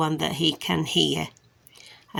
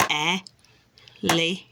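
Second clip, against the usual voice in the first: el, e, phant with a gap after each beat; separated syllables; much slower than usual. Shown as a demonstration. Making a toy jump per beat, syllables separated. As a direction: break elephant into three slow, separate hops. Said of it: "jump up and down that".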